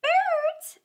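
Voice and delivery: very high, loud squeaky outcry; bat crying out. A narrator's reading. Bat protesting to the weasel, high and squeaky. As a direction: squeal the word in shock. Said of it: "cried the bat".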